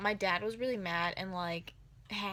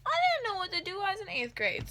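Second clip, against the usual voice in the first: loud, high protest; defensive yell; 11 semitones higher, 5 dB louder than usual. Retold or imitated. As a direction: argue back with dad. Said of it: "I'm like".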